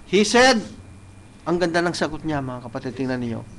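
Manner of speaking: ordinary delivery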